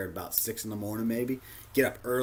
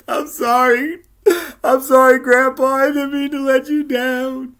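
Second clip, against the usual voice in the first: high, loud, tearful apology to Grandpa; hammed-up sobbing plea for forgiveness; mock crying; 12 semitones higher, 12 dB louder than usual. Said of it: "I felt like crying".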